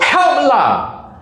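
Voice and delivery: high, sharp Singlish "help lah"; irritated gamer scolding; annoyed demand. Retold or imitated. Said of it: "And then the guy goes".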